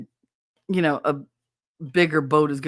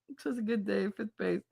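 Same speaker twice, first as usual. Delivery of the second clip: softer, half to herself; muttered to herself, softer; quiet repeat; 3 semitones higher, 10 dB quieter than usual. Real, not acted. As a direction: murmur the line again. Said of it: "repeating chat line".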